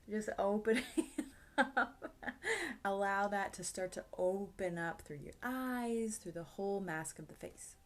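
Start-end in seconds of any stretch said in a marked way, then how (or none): 0.1-2.1 words broken by a real giggle; laughing. Real, not acted. Teacher cracking up mid-demo, laughing through it. Laughing while demonstrating face stretch.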